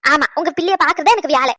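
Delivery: loud, indignant retort; sudden irritated outburst; angry. Acted. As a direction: snap back at the in-law. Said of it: "இவ்வளவு கோவப்படுறீங்க".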